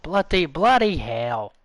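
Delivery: hammed-up, irritated British; British accent. Playing a Brit trying to work, mock-annoyed British accent. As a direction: act put out in a British accent. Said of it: "it actually sounds British".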